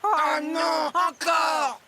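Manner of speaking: loud, whiny protest; disappointed shout. Acted. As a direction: yell it out in dismay. Otters dumped off the ride. (cri de déception)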